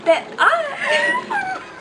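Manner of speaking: sudden high shriek from the shock; shriek at cold water. Real, not acted. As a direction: scream as icy water hits. Ice water poured on her.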